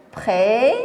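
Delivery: précis in two parts, ending on i; silent final S. Shown as a demonstration. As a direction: leave the S of précis unsounded. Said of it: "c'est le S". (précis avec le S muet)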